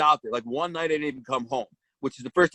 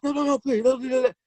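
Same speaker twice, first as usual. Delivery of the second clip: mock pleading; 9 semitones higher than usual. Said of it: "She's like".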